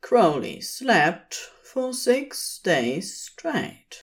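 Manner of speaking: ordinary delivery, nothing marked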